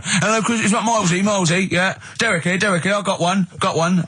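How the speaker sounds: high, babbling mock caller; eager phone-in caller. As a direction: push in excitedly with repeated words. Acts eager radio phone-in caller.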